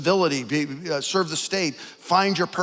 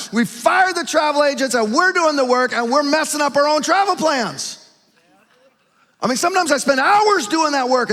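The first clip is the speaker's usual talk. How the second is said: high, loud, driving sermon delivery; loud, high, riled-up preacher rant; full-force preaching; 9 semitones higher, 7 dB louder than usual. Acted. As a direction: build it up and hammer it home to the room.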